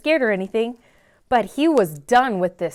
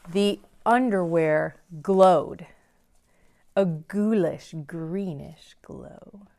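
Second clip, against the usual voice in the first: spooky; 3 semitones lower, slower than usual. A narrator's reading. Spooky reveal of glowing underwear.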